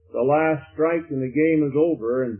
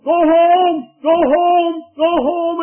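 high, loud cheering shout; kids yelling; 13 semitones higher, 7 dB louder, much slower than usual. Acted. Kids cheering on the little runner, yelling. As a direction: urge the runner home at full voice. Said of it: "all the kids were up yelling".

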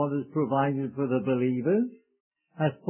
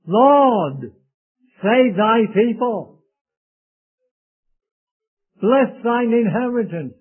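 a preacher reading a prayer aloud, louder; fervent scripture reading; 3 semitones higher, 5 dB louder than usual. Acted. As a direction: lift the psalm up like a plea. Reads psalm as prayer.

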